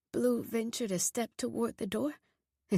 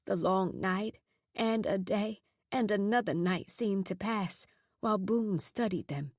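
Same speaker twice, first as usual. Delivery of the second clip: usual speaking voice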